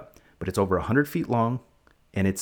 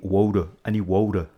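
put-on insistent Philly accent on "water"; mock Philadelphia local. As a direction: say "water" as "wooder", Philadelphia style. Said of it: "They water in Philadelphia".

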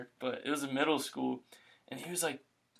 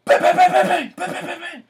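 loud, high mouth-noise bangs; imitated gunshot bangs; 12 dB louder than usual. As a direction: pop out gunfire noises. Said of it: "you hear".

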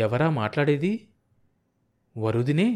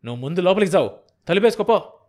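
loud angry snap, higher at the end; husband snapping at his wife; 5 semitones higher, 6 dB louder, faster than usual. Acted. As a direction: bark the order to go inside. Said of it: "కసిరాడు".

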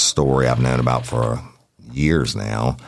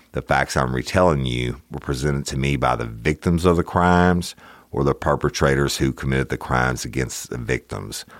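usual speaking voice

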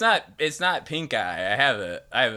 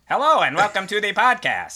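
mock announcer voice; 8 semitones higher, 5 dB louder than usual. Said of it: "you went with that bit".

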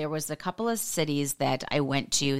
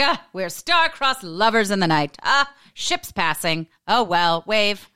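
theatrical lovelorn sigh, louder and higher; hammed-up mock romance; 5 semitones higher, 6 dB louder, slower than usual. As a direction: play the tragic lover for laughs. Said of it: "I was like".

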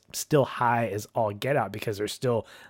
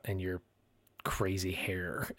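slow teasing whisper on crazy hair; teasing joke; 3 semitones lower, 8 dB quieter than usual. Retold or imitated. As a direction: whisper the tease, slowing down. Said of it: "jokes about".

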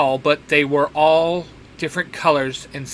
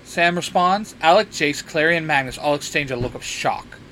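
ordinary delivery, nothing marked

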